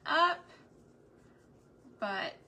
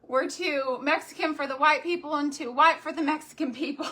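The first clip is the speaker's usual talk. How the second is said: loud, higher mock complaint; playfully hammed-up fatherly rant; exasperated dad; 5 semitones higher, 8 dB louder than usual. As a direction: imitate a movie dad venting, louder. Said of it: "her dad's all".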